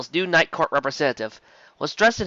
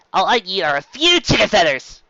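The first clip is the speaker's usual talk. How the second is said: calling back; 6 semitones higher, 7 dB louder than usual. A narrator's reading.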